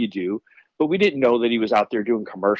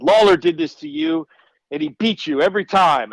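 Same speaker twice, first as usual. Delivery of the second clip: ring taunt; 7 semitones higher, 4 dB louder than usual. Retold or imitated.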